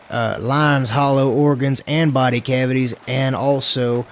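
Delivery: slow, drawn-out southern drawl; southern accent. Pretend. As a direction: read it out like a southern gunslinger. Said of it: "read that in your southern accent".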